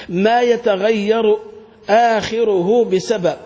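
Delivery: "ما يتغير آخره" very slowly stressed; slow quoted definition. Shown as a demonstration. Teacher quoting the definition for the class slowly. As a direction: read it out slowly. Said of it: "لما قال".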